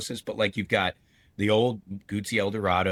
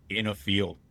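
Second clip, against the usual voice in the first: ordinary talk, nothing marked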